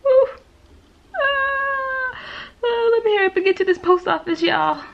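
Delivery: high, fast panicked hurry; flustered rush. Real, not acted. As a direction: scramble to get out the door. Suddenly remembers packing.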